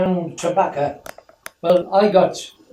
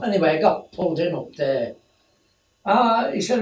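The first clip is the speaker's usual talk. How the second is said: usual speaking voice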